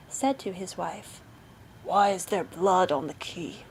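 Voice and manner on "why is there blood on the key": low, far-off stern voice; stern interrogating husband. A narrator's reading. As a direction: demand it like a suspicious husband. Bluebeard interrogates his wife.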